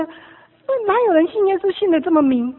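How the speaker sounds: exaggerated judgmental question; mock disbelief. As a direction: protest like an incredulous critic.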